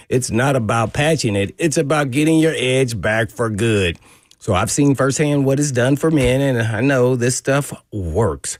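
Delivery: smooth, steady sponsor read. Acted. Host reading a sexual health sponsor ad on air, smooth pitch. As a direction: sell it like a radio spot.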